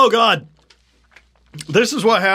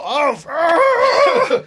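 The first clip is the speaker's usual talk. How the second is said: pained gasp; 8 semitones higher than usual. Real, not acted. Gasping from the burning.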